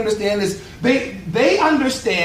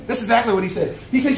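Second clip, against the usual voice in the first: usual speaking voice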